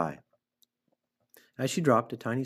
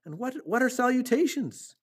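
high, loud, frantic begging; excitable stammering outcry; screamed plea; 13 semitones higher, 4 dB louder than usual. A narrator's reading. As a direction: plead urgently, pitched right up. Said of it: "screamed Wilbur".

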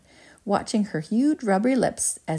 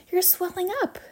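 high, contained shriek; horrified scream; 12 semitones higher than usual. A narrator's reading. Mother sees Violet swelling, high panicked scream. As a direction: squeal it in alarm without volume. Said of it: "screamed Missus Beauregard".

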